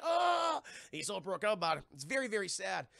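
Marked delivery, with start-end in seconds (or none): 0.0-0.6 high, loud drawn-out "oh"; hammed-up cry of hurt; mock heartbroken wail. Rejected lover all broken up, mock heartbroken wail.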